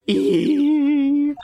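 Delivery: long, high, loud squeal; shriek. Acted. Character spotting a corpse, high sustained shriek. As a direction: let out a piercing held "eee".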